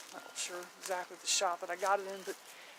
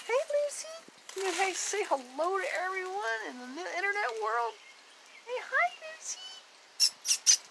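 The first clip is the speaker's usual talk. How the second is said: high pet-talk; 12 semitones higher than usual. Real, not acted. Calling to Lucy for viewers.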